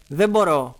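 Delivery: put-on high-pitched child's desperate plea; acted distress. Child in script begs. (απεγνωσμένα, σαν παιδί)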